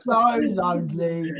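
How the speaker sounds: slow, drawn-out mock lament; melodramatic, laughing cat wail; lonely cat. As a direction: stretch it out like a pining cat. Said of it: "like a cat out the window going".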